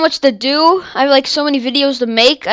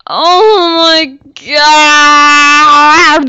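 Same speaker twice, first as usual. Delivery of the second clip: drawn-out wail; 2 semitones higher, 5 dB louder, much slower than usual. Real, not acted. Despair over dying.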